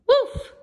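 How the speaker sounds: high, loud mock bark; playful woof. Arfie signing his letter with a playful woof. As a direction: bark it like a happy pup.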